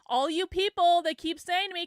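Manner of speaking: usual speaking voice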